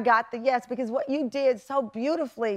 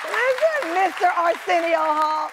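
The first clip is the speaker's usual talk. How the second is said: high, emphatic call of his name; exclaiming admiringly; 6 semitones higher, 4 dB louder than usual. Real, not acted. Host to her guest after his praise, excited exclamation. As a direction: exclaim it over the applause.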